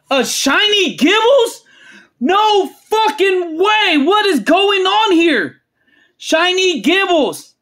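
high-pitched, incredulous exclamation; stunned disbelief. Real, not acted. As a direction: exclaim it like you can't believe your luck. Disbelief at pulling a shiny card.

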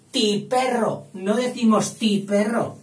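high, loud stress on "Ti perro"; emphatic counterexample. Shown as a demonstration. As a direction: hammer out "Ti perro" as something nobody says. Teacher hammers the wrong form.

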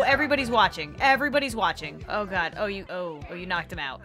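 normal talking voice

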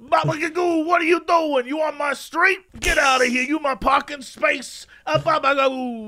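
put-on high, shouted New York accent; New York guy. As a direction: rant like a territorial New Yorker guarding a parking space. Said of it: "my New York guy".

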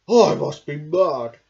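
loud, fed-up cartoon voice; exasperated man. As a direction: grumble in disbelief. Man drives them home.

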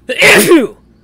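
sudden, explosive loud sneeze; real sneeze. Real, not acted. Narrator breaks off to sneeze.

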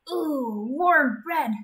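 thrilled about bread; excited. Acted. Excited character.

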